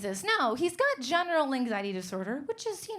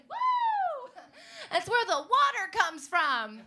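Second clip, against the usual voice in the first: mock cheer; 12 semitones higher, 5 dB louder, slower than usual. Lone cheering audience member imitated.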